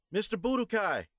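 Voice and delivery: high, loud call across the street; yelling a name. Acted. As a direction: shout his name to get his attention. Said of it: "I yelled".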